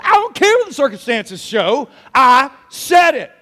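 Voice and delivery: emphatic acted rebuttal, slowing at the end; mad retort. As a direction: cut off the objection with firm authority. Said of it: "he was mad".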